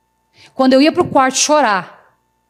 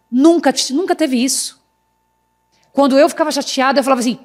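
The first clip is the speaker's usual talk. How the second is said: ordinary talk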